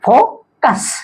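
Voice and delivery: "फो", pause, "कस"; फो-कस with a clear gap; syllable by syllable. Shown as a demonstration. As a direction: break फोकस into two beats. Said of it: "ये भी देख रहे हैं डायसिलेबिक".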